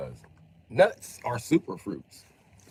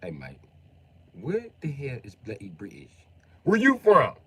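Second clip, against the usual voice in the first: mock British accent. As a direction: put on a lazy British accent. Mocking Hunter's British talk.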